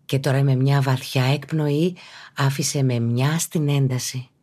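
usual speaking voice

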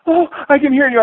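imitating a wife finally hearing him; mock tearful relief. Wife relieved the call connects, mock tearful and high. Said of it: "and she's crying".

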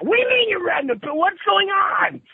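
very high-pitched exasperated outburst; frantic disbelief. Retold or imitated. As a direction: re-enact total bewilderment, pitch through the roof. Acts out his frantic questioning.

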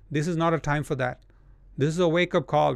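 normal talking voice

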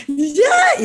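high, loud burst; sudden high cry. Retold or imitated. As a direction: let it break out high. Retold first tear release. (un "ya" agudo y fuerte)

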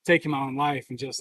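ordinary delivery

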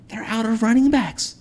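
high, emphatic point; worked up. Real, not acted. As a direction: nail the point. Heated football argument.

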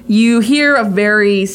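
ordinary talk

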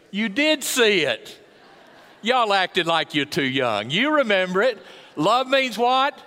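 loud, high challenge to the congregation; preaching at full force. Real, not acted. Preacher teasing the congregation about a film line, full force. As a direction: call them out with big, punchy projection.

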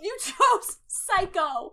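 loud, slow, stretched-out disbelief; disbelieving. Real, not acted. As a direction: drag it out in shock. Repeats in disbelief.

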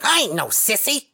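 high, loud indignant cartoon voice; hammed-up high cartoon protest; cartoon tough guy. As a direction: bark it like an offended cartoon pimp. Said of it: "He was like".

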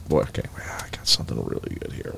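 whispered under the breath; whispering. Real, not acted. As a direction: whisper it to yourself. Finding the clip.

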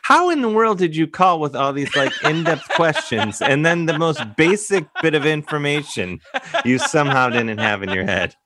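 laughing through a teasing question; can barely get the words out for laughing. Real, not acted.

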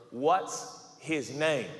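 spaced-out, demanding question; slow interrogation. Acted. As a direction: drag each word out as you demand it. Jealous Joe interrogates Mary.

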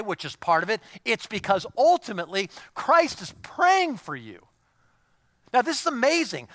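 loud, high, driving sermon voice; fired-up pulpit preaching; preaching at full force. Acted. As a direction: preach it out to the whole room, pressing hard.

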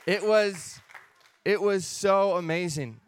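slow words through a happy laugh; laughing with joy. Real, not acted. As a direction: let the laugh spill into the words.

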